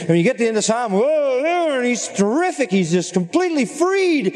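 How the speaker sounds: excited preacher; excited wonder. Real, not acted. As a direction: marvel at the psalm's triumphant ending. The psalm ends in triumph.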